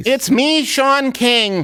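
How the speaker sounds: high, drawn-out put-on voice; mock Sean King's other voice. Mock activist trying another voice on the smart speaker, high put-on voice. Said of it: "I have to use my other voice".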